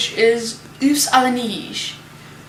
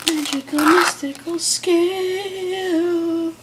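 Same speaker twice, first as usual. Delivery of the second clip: mystical voice; 7 semitones higher, slower than usual. Pretend. Playful aside about the scale.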